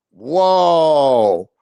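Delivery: long low falling whoa; stunned whoa. Real, not acted. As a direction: drop into a drawn-out whoa. Reacting to chat news.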